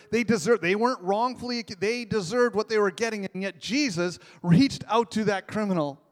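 full-force preaching. Acted.

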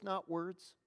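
usual speaking voice

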